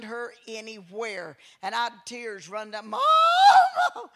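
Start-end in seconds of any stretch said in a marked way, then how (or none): 2.9-4.1 much higher, much louder panicked wail for mama; frantic child's cry. Retold or imitated. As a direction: scream for mama like a scared kid. Crying child searching for mother.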